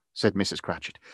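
ordinary delivery, nothing marked

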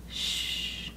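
soft whispered shush; hushing whisper. Real, not acted. Teacher quieting her listeners with a whispered shh. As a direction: hush the room with a breath. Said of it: "teacher hushing the class".

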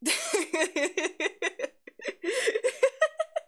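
long, high-pitched run of giggles; real giggling fit. Real, not acted. Streamer still amused by the catboy voice, long high giggles. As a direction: keep giggling high, unable to stop. Keeps laughing after catboy voice.

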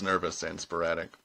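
ordinary delivery, nothing marked

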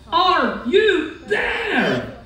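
high, long-drawn searching call. A narrator's reading. Horton searching the clovers for his friends, calling out. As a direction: stretch each word out as if calling far. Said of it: "searched them and called".

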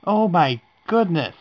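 high, loud startled cry; startled shout. Real, not acted. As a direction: jump at the scare and yell. Reacting to scary gif.

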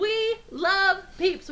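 slow, high, drawn-out gushing. Real, not acted. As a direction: stretch it out lovingly. Gushing about favorite candy.

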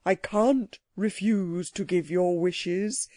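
slow, simmering creature voice; measured anger, each word stretched; very angry. A narrator's reading. Psammead to the Queen who demands wishes, angrily. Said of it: "and spoke very angrily".

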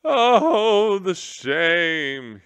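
high, loud, wailing general; the weeping general wailing his shame; wretched cry. Acted. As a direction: cry out in misery. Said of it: "he cried wretchedly".

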